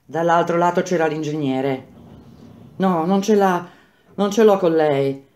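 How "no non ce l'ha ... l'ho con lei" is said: panting. Acted. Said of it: "ansimava Quinto".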